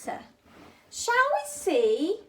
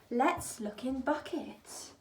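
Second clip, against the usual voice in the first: whisper; 3 semitones lower, 7 dB quieter than usual. Real, not acted. Building suspense before opening bucket.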